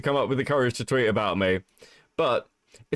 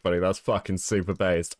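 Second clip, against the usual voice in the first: normal talking voice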